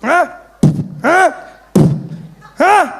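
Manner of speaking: high, loud grunted huh; hammed-up cop grunts with each kick; police grunts. Police kicking the couple, loud grunts. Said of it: "they'd kick you".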